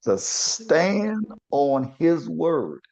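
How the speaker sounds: high, drawn-out preacher's cadence; preaching exhortation. Real, not acted. Co-host urging courage to confront a friend, preaching it high and slow. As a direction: stretch key words and leave weighty pauses.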